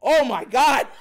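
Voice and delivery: loud startled gasp, laughing at the end; startled batter. Retold or imitated. Tracy shocked by the pitch, loud and startled. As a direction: flinch at a fastball, half laughing. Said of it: "you see Tracy go".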